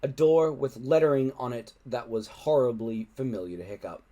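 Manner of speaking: usual speaking voice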